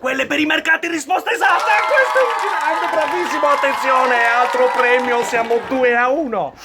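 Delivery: loud high cheering verdict; excited quiz host. Acted. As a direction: celebrate the right answer. Host cheers correct answer. (conduttore entusiasta che esulta)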